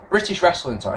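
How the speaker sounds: ordinary talk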